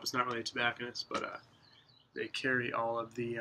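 usual speaking voice

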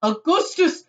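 loud call, moderately high; father crying out. A narrator's reading. Father calling his son, crying out. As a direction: fatherly shout with a rising end. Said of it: "cried Mister Gloop".

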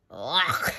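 low, choked "ugh" of disgust; acted retch; disgusted gag. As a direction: gag on a nasty drink. Hen forced to drink buttermilk.

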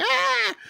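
high imitated scream; a short mock shriek. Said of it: "there's just more screaming".

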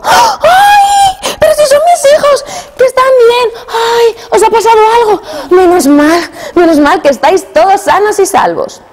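very high, loud cries of relief; a mother crying out as her lost children return; overjoyed mother. Acted. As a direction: shriek with joy and relief. Mother overjoyed children are back.

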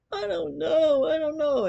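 high, loud tearful wail; crying child. Retold or imitated. Scared child crying to the teacher, high and tearful. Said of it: "I'm still crying".

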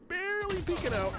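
very high, strained suspense; high-pitched nervous call; tense play-by-play. Real, not acted. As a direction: squeeze it out high on the edge of your seat. Tense live call of peek.

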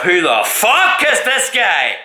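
baffled. Retold or imitated. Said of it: "I was saying to myself".

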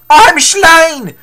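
high, loud theatrical death cry; shouted. Acted. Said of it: "shouted Depneu".

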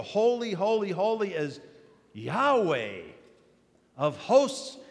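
high, loud, ringing holy, holy, holy; proclaiming. Acted. Seraphim calling to one another, loud proclamation. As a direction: call it out like angels crying praise.

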